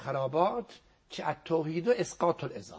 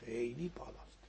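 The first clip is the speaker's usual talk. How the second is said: whispered, hushed in awe; a soft unvoiced whisper of wonder; awed whisper; 2 semitones lower, 13 dB quieter than usual. Real, not acted. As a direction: breathe it out reverently. Awed at Zahra's rank.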